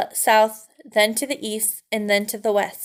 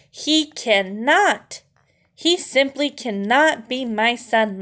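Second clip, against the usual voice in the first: slow, heavy disbelieving father; slow despair; 2 semitones higher, slower than usual. Acted. Father mouse refuses to accept his son. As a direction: drag each word out in despair.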